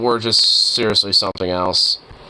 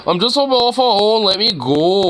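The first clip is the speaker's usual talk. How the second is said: high, louder mock whine; mimicking the driver; 9 semitones higher, 4 dB louder than usual. Player mimics the video's driver pleading with police, high whine.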